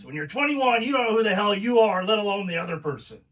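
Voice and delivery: high, fast in-your-face father warning; imitating his dad. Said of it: "I'm gonna do an imitation of my dad".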